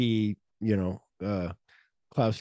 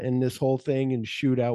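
usual speaking voice

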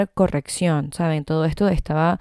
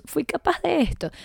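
mucho más aguda, sorprendida de sí misma; celébrate con asombro; asombro alegre; 10 semitones higher than usual. Retold or imitated. Celebra su propio logro. (asombro alegre, muy agudo)

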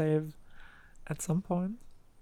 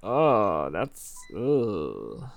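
low, disgusted "ugh"; annoyed ugh; 8 semitones lower, much slower than usual. Real, not acted. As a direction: groan in disgust. Disgusted groan at parents.

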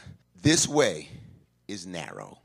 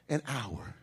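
ordinary delivery

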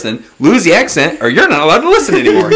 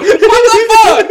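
laughing outburst; much slower than usual. Pretend. Driver behind reacting.

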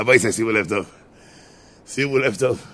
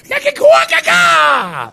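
drawn-out possessed screech, very high; dybbuk voice; 9 dB louder, much slower than usual. Acted. Husband possessed by rage at wife, dybbuk screech. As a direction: shriek gibberish loudly like a demon. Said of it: "נהיה לו קול של דיבוק".

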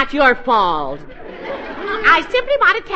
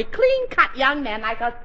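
usual speaking voice

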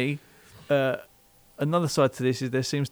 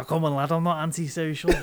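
chav lad protesting; 5 semitones higher than usual. Pretend.

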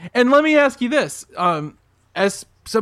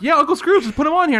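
higher-pitched mock of the others' casual agreement; mocking imitation of voters; 8 semitones higher, faster than usual. Co-host accuses the others of lying, mocking imitation. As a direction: imitate them breezily waving it through, pitched up. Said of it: "All of you are like".

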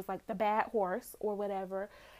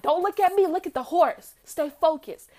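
louder, higher retold command; father coaching his son with the wild horse, insistent; stern father's command; 6 semitones higher, 8 dB louder than usual. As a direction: bark at his son to stay focused. Hart commands Cole at horse.

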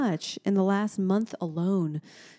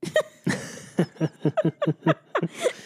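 laugh; 3 semitones lower, 3 dB louder than usual. Real, not acted. Mom laughing at story.

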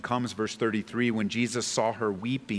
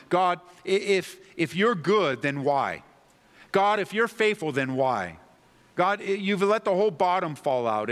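doubting prayer; 7 semitones higher, 4 dB louder than usual. Pretend. Believer questioning God's plan, higher and louder. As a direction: press God with frustrated questions. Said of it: "it sounds something like this".

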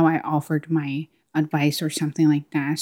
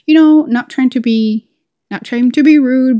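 mild playful protest; 6 semitones higher, 8 dB louder than usual. Retold or imitated. Telling interviewers a joke.

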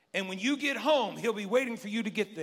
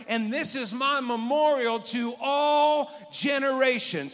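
louder, higher and slow shouted climax; preaching shout; 7 semitones higher, 7 dB louder, slower than usual. Acted. Pastor reading God's words to the congregation, shouted preaching climax. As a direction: shout it out to the whole church.

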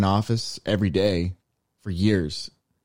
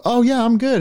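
much higher, mock-polite elevator reply; forced friendly reply; 13 semitones higher, 3 dB louder than usual. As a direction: play at being politely upbeat. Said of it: "but then you go".

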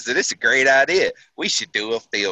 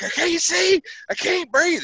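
mock panic. Driver in a smoke-filled cab crying out to his sergeant, high mock panic. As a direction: replay the choking panic. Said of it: "And I'm like".

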